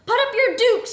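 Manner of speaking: high, loud fighting challenge; full-volume combative yell; scream. A narrator's reading. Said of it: "screamed the Swedish Beaver".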